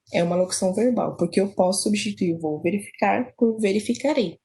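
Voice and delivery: ordinary delivery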